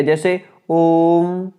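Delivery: ओम with the ओ held very long, slowly; chant-like stretch of ओम; prolonged vowel demo. Shown as a demonstration. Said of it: "तीन गुना समय अधिक लगता है प्लुत स्वर". (ओम को प्लुत स्वर में बहुत लंबा खींचकर)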